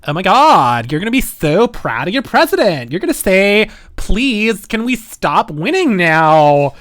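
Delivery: put-on loud valley girl accent, slowing at the end. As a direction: gush like a valley girl quoting Trump. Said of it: "most stereotypical Valley Girl accent".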